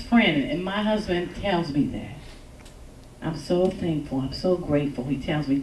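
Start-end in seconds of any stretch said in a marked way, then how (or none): none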